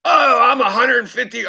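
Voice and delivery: high-pitched mocking quote; mocking imitation. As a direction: parrot the boast in a high, shouted voice. Mocks what the woman claimed.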